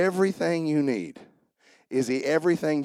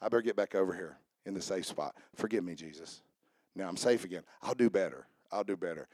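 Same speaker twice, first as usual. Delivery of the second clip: mock sinner; 7 semitones lower, 7 dB quieter than usual. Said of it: "then you're like".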